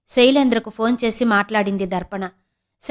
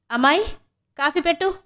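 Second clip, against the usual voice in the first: loud call; 6 semitones higher than usual. A narrator's reading. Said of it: "అన్నాడు గట్టిగా ప్రమోద్".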